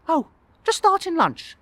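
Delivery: high, loud character voice; receptionist voice. Acted. Receptionist to a late wedding guest, high voice. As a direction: put on a high receptionist, drawing it out.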